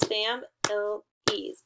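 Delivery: slow "Fam ill ease" in three separate pieces; syllable by syllable. Shown as a demonstration. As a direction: count out "Fam ill ease", a gap after each syllable. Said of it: "three fingers for three syllables".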